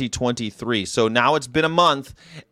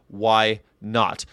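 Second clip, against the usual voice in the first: usual speaking voice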